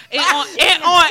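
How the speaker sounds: high, loud laughing burst; laughing while talking; loud laughter. Real, not acted.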